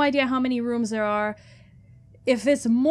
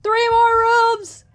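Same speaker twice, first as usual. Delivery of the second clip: very high, loud panicked shouts; panicked shouting; 12 semitones higher, 12 dB louder, slower than usual. Real, not acted. Player panicking near the end of the run. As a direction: shout in frantic fear. Final chase.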